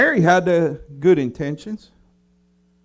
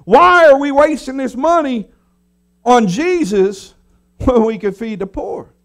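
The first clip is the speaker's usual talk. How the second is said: louder, higher mock crowd outrage; bandwagon crowd; 5 semitones higher, 5 dB louder than usual. Preacher voices the crowd joining in, mock indignant. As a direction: whip up an indignant crowd, rising. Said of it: "other people's like".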